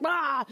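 high exasperated "ah"; short high cry of exasperation; frustrated outburst cry. Retold or imitated. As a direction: let out a burst of frustration. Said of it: "And then I'm like".

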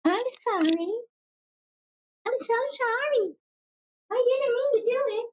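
hammed-up high-pitched sorry; mock apology. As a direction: whimper the apology mockingly. Mock apology, much higher.

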